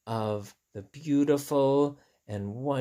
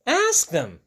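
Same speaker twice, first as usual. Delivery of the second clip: higher, louder exclaim; eager insistence; 6 semitones higher, 6 dB louder than usual. Acted. Piggy urging Elephant, insistent and loud. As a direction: push the idea eagerly.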